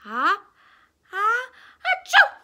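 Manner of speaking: loud, rising ah-ah-achoo; big acted sneeze; building sneeze. A narrator's reading. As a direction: climb in pitch to an exploding sneeze. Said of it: "with a sneeze".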